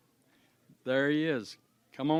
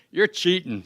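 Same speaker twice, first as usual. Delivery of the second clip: accusing; 5 semitones higher, 6 dB louder than usual. Retold or imitated. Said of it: "And she says".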